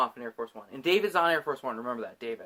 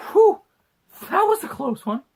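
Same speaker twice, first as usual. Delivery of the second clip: loud, high mock sigh of relief; over-the-top near-miss relief; exaggerated relief; 14 semitones higher, 9 dB louder than usual. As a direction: blow out a big hammy whew. Acting relieved character after explosion.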